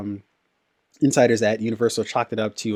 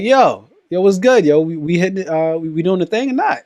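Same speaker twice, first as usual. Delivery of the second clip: higher and louder, pumped up; hyped bro call; 7 semitones higher, 5 dB louder than usual. Retold or imitated. Friend checking on Friday plans, hyped up. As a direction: hype your buddy up for Friday night. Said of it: "you're like".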